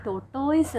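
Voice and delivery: "ടോട്ടോയിസ്" ending in toys; wrong pronunciation. Shown as a demonstration. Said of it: "അത് തെറ്റാണ്".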